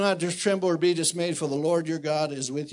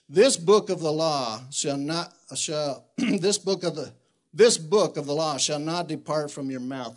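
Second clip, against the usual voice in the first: preaching at full force; 5 dB louder than usual. Acted. Preacher charging the men with the verse, full force. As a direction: thunder the verse out from the pulpit.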